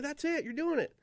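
high excited exclamation; a thrilled, high-pitched confirmation. Retold or imitated. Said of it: "He said".

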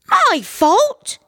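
very high, loud outraged wife; shrieky wife's comeback; indignant retort. A narrator's reading. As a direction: throw it back in a high, offended voice. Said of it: "retorted his".

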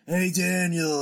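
loud, raspy-sounding call from the screen; hammed-up Triple H shout; Triple H impression. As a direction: strain the throat for a Triple H call. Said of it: "That was pretty good".

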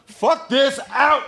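high, drawn-out yelling tantrum; mock outrage. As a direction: storm off in hammed-up fury, laughter underneath. Pretends to storm off angrily.

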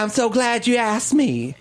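breathy, slow, laughing Hillary; Hillary impression. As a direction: play a flattered politician. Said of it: "She didn't talk like that".

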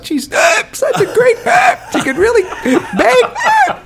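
high, loud laughing cries broken by gasps; imitating laughing audience. Actor retells the audience, mock laughing wildly. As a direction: squeal with laughter through the praise. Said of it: "'cause they're going".

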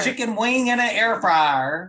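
put-on slow, high, loud country accent; exaggerated country-accent mimicry; imitated country accent. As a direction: echo it in a drawn-out country accent. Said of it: "I couldn't handle the accent".

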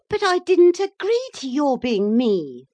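put-on high, loud outcry of a wronged boy; indignant protest. A narrator's reading. Maurice objects to the cat being him, indignant.

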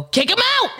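high shouted "Kick him out!"; yelling at the TV. Retold or imitated. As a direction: yell it at the screen, pitch way up. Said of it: "I go".

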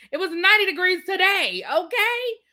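high, laughing disbelief; high, incredulous laughing exclamation; laughing exclaim. Real, not acted. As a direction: laugh it out in disbelief, pitch soaring on okay.

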